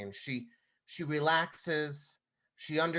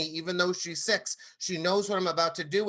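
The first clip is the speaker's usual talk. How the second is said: usual speaking voice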